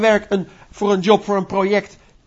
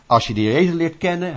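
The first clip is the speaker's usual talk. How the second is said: ordinary delivery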